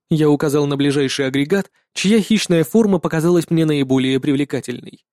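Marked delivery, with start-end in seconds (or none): none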